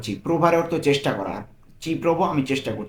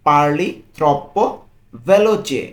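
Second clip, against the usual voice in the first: slow model phrase; 5 dB louder, much slower than usual. Shown as a demonstration. Teacher repeats the phrase for a learner, building up. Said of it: "তুমি খুব দ্রুত কথা বলো".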